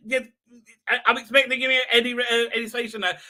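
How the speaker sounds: ordinary talk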